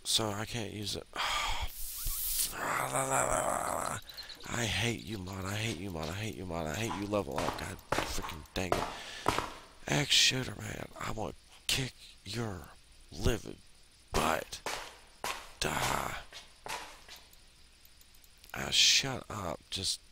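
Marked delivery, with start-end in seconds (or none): 1.1-4.5 long high babbling wail; frustrated wail. Real, not acted. Player who can't use it, frustrated wail. 4.5-16.2 high, heated ranting at an enemy; angry rant. Real, not acted. Player cursing the level-up mod, angry rant. 18.6-20.0 sputtering, raised-pitch annoyance; exasperated snapping. Real, not acted. Player telling the stupid thing off, exasperated snapping.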